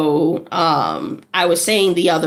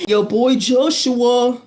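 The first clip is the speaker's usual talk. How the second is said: put-on light New York accent; New York shout-out; 3 semitones higher than usual. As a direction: hype shout-out. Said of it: "New York accent".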